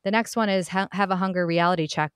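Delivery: ordinary talk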